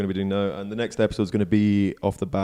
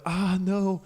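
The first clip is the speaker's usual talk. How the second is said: high, whiny, hammed-up imitation of a moaning friend; mock whining; 10 semitones higher, 4 dB louder than usual. Teasing Phil on the podcast about his drone cut, high mock whine. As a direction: play up his fuss over a scratch, pitched high and wailing. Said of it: "He's like".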